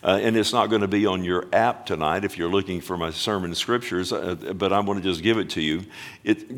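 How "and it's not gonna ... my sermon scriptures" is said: normal talking voice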